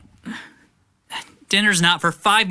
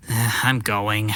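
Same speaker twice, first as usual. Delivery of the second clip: low sulky sigh; 7 semitones lower, 3 dB quieter than usual. Acted. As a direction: grudgingly give in. Teen gives in sulkily.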